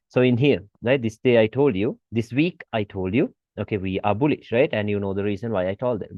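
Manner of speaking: ordinary talk, nothing marked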